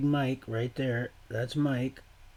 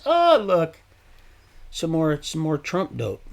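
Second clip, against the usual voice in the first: excited exclaim; 6 semitones higher, 9 dB louder than usual. Real, not acted. Spots something while filming.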